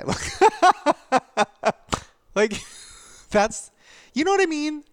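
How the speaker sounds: slow, high laugh through words. Real, not acted. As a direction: laugh off the hurt. Laughing about the trolls.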